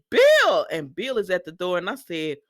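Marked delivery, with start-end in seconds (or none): 0.1-0.7 high, loud gasped name; shocked cry. Retold or imitated. Steffi crying out as Bill bursts through the door, shocked. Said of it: "Steffi says".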